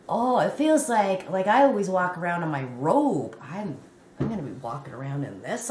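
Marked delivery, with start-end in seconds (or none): none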